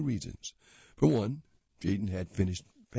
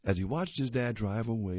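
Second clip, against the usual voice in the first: usual speaking voice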